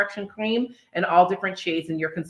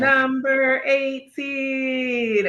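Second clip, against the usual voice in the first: slow, high, drawn-out number call; bingo caller; 6 semitones higher, much slower than usual. Acted. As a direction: announce the number like a bingo host.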